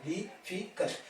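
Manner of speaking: difficult softly split as di, fi, cult; soft syllable-by-syllable difficult; three natural divisions. Shown as a demonstration. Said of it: "Three natural divisions".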